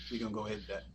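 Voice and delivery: normal talking voice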